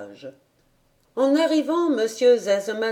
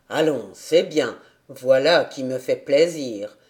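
low father voice; 6 semitones lower than usual. A narrator's reading.

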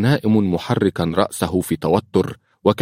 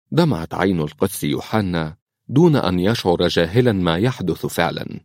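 plain reading voice

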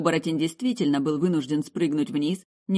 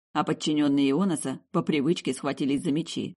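plain reading voice

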